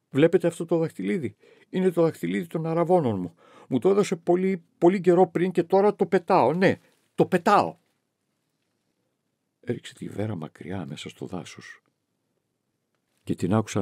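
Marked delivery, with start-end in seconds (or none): 0.1-7.8 higher, louder and rising in agitation; agitated outburst. A narrator's reading. Victoria shows Johannes the ring before throwing it, agitated. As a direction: fling the words out as she throws the ring.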